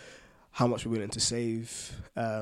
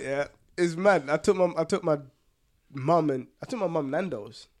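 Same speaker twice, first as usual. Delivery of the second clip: normal talking voice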